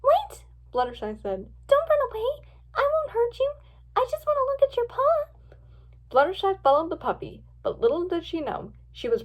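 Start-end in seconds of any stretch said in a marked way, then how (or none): 0.0-0.4 put-on high, louder girlish call; sudden high-pitched plea. A narrator's reading. Girl calls after fleeing puppy. 1.7-5.6 put-on very high girlish voice; high-pitched, earnest reassurance; gentle girl, pleading. A narrator's reading.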